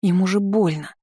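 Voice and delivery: a touch of worry, pitch lifted; concerned for someone hurt; worried protest. A narrator's reading. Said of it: "забеспокоилась я".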